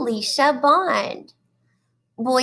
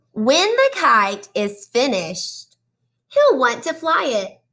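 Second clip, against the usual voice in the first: put-on reading to children; 2 semitones higher than usual. Teacher reading a picture book to her class, animated storytelling. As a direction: draw the kids into each page.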